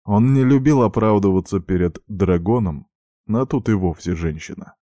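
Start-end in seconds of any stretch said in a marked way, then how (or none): none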